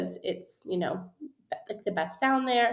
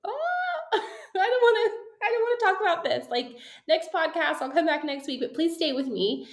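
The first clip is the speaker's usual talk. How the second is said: much higher, whiny mock protest with a laugh; mock reluctant listener; 7 semitones higher than usual. Number-shy listener begging to skip the episode, high whiny mock protest. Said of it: "you're like".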